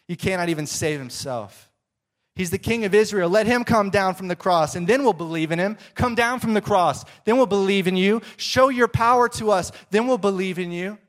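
religious leaders mocking the man on the cross; mockery. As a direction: jeer like smug leaders. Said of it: "joined in the mockery".

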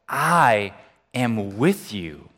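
"I am with you" drawn out, weight on "with"; slow emphatic verse. Acted. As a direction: linger on every word of "I am with you". Key verse read slowly.

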